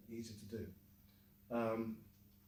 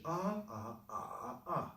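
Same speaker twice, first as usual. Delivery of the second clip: AH recited once per tone, high level, rising, dipping, falling; tones in isolation; 4 semitones higher, slower than usual. Shown as a demonstration. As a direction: separate ah syllables, each with its own tone contour. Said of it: "learn the tones in isolation".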